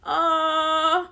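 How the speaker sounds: high, loud wailing "aw, uh"; mock wail. Reacting to the dad taking his wife home, a mock cry. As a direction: wail it like you're about to cry. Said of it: "I said".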